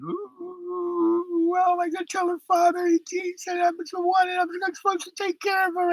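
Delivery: very high, wailing falsetto, mocking his own crying; mock sobbing falsetto. Husband mocks himself crying at the window, in a wailing falsetto. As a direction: squeak it out like a blubbering mess.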